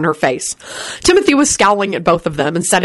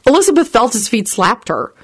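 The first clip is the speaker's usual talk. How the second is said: usual speaking voice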